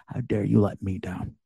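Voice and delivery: usual speaking voice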